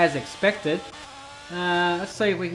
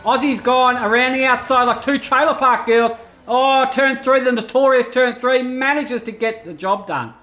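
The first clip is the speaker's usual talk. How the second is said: higher, louder play-by-play burst; excited race call; 5 semitones higher, 4 dB louder than usual. Real, not acted. Commentator calls Aussie's move on Kush, excited. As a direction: call the pass with rising excitement.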